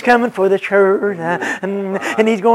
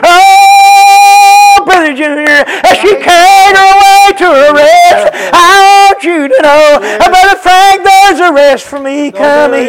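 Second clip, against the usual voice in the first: higher, louder, held, sung-out whoop; whooped preaching; 7 semitones higher, 6 dB louder than usual. Acted. Pastor promising rest to his congregation, full whoop. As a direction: belt it out like a singing preacher.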